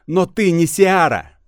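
high, loud and slow defiance; defiant. Acted. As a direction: throw the denial back at her. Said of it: "чуть ли не дерзко". (дерзко, с вызовом)

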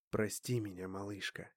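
soft, low, guilty murmur; guilty apology. Acted. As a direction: apologize quietly with remorse. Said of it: "виновато проговорил демон".

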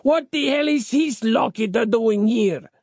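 high-pitched indignant question; outraged demand. A narrator's reading. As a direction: demand an answer, voice pitched right up. Outraged father confronts captive.

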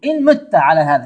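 إن مت على هذا said loud and firm; stress on the condition. Shown as a demonstration. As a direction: lean hard on إن مت. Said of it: "قل له".

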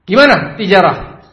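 acted impatient employer; demanding boss. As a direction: demand the business report sharply.

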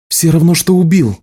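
high-pitched, heated retort; accusing. A narrator's reading. Healer blames the marked man, high-pitched accusation. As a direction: throw the blame back at once.

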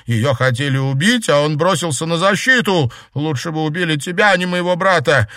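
distraught accusation. Acted. Grieving sister turning on the survivor, distraught accusation. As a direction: wish the other had died instead.

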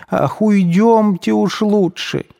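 high, slow pleading; frightened plea. A narrator's reading. Испуганная мать просит всех уйти, высоким умоляющим голосом. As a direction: beg them all to leave, alarmed.

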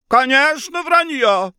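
high, exclaimed agreement; emphatic agreement. Acted. Mother agreeing the Rembrandt claim is false, heated. Baroness agrees mid-quarrel.

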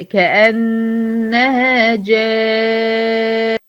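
"جان" with a very long nasal noon, recited slowly; held ghunna. Shown as a demonstration. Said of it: "هقف عليها أقول". (غنة طويلة على النون المشددة في "جان")

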